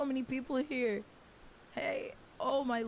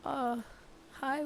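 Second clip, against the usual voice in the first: usual speaking voice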